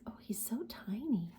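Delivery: soft marvelling whisper. Real, not acted. Pointing out the tiny mouse, a delighted whisper. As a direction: whisper it as if not to wake him.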